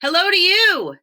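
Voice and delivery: high loud cheery shout up; shouted back. Acted. Woodcutters shouting up to Walter, loud. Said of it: "they shouted back".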